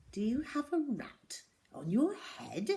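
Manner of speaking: hushed, breathy teacher's question; whispered question. A narrator's reading. Miss Midge peering, questioning Zoe.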